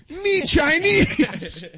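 slow, loud "Me Chinese"; loud announcement. Retold or imitated. Prankster walking up to a stranger, loud slow declaration. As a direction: blurt it out loudly at a stranger. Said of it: "very loudly goes".

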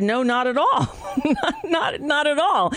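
laughing through her own words; retelling her reply while laughing. Real, not acted. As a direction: giggle out the denial.

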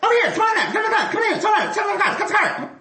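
very high, laughing barker call; carnival barker. Shown as a demonstration. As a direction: beckon the crowd in, squealing. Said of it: "I'm like".

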